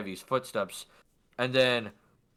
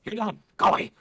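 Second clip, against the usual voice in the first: high goblin voice; dismissive goblin woman; 9 semitones higher, 4 dB louder than usual. Acted. Goblin woman eyes the package, then dismisses courier in a high voice. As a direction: shoo them off abruptly. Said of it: "she just looks at it and goes".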